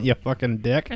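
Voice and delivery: normal talking voice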